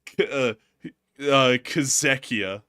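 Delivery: slow Kazekia, kʰəzɛkiə, stressed on ZEK; made-up name. Pretend. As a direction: draw out Kazekia like a joke country. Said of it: "I'm gonna call this one".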